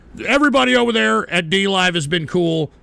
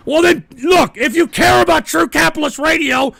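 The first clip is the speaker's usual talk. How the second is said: high, raised-voice rebuttal; real flare of temper; angry retort; 7 semitones higher, 5 dB louder than usual. Real, not acted. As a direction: argue back in exasperation. Snaps back at chat message.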